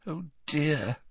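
a small, stricken voice; Hermione, stricken. A narrator's reading. Hermione seeing Hagrid slam his door, stricken. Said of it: "looking stricken".

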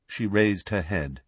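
ordinary delivery, nothing marked

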